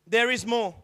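ordinary talk

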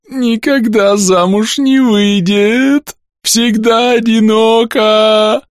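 high, slow, wheezing squeak. Acted. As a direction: choke the words out, breathless. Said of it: "едва сумел просипеть".

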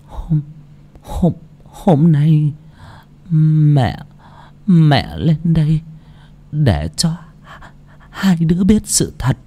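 put-on slow faltering old voice; trembling voice. A narrator's reading. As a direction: struggle out the words, tired and shaky. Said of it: "run run giọng".

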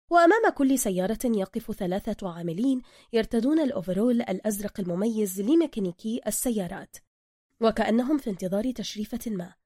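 usual speaking voice

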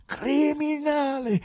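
high, loud, very slow roar; the peak of the accusation, let it ring; full shout. Acted. Pilate roaring so he is heard in the garden, full shout. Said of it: "Alzò ancora la voce".

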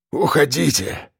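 strained, croaked urging; hoarse rasp. A narrator's reading. Fallen man sending rescuers away, hoarse rasp. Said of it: "прохрипел он".

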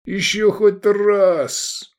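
high, very slow pained moan; groaning. A narrator's reading. Hungover Iskander swearing off drink, drawn-out groan. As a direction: moan it out in misery. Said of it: "застонал Искандер".

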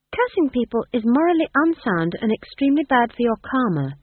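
earnest moral lecture; prim young witch. A narrator's reading. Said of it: "said Magrat".

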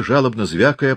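ordinary delivery, nothing marked

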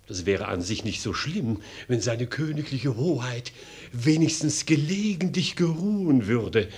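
ordinary delivery

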